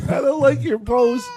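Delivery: put-on high, babyish whine; whiny man child. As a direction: whine it like a pouty kid. Mocking the kid, high voice.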